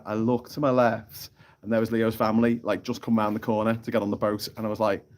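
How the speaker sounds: usual speaking voice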